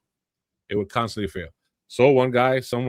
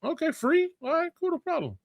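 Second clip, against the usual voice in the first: much higher, airy mock voice. Podcast host mimics a casual gamer shrugging it off, mock high voice. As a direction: play the carefree gamer in a squeaky put-on voice.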